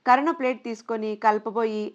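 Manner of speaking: usual speaking voice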